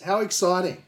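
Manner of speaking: high, loud and sarcastic. Acted. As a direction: say it with heavy sarcasm. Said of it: "I sense some sarcasm".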